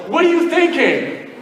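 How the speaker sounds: high-pitched, drawn-out mock yell; retold yell. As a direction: call it out high and incredulous across a canyon. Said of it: "he stops and he yells".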